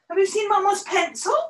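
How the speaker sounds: pet talk. Real, not acted. Asking the dog.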